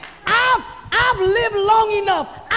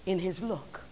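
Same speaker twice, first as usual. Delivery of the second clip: normal talking voice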